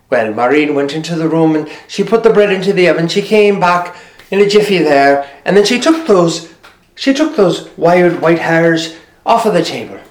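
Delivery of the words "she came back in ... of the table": ordinary delivery, nothing marked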